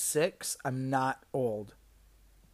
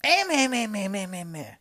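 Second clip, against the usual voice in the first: much higher and much louder, furious customer mock rant; angry customer; 11 semitones higher, 10 dB louder than usual. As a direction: rant at the staff like an outraged customer, trailing into meh meh. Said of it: "was like".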